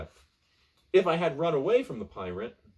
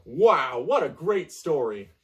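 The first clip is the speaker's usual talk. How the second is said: sarcastic praise; 3 semitones higher, 8 dB louder, slower than usual. Real, not acted. Mocking the abrupt ending.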